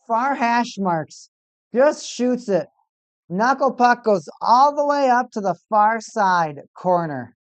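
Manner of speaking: high, animated goal recap; excited replay. Real, not acted. Fan excitedly describing Kucherov's goal to viewers, animated and high. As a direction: relive the shot with rising excitement.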